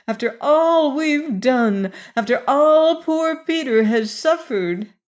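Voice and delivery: a mother laying on the guilt, building; with added feeling. A narrator's reading. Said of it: "put added feeling into her words".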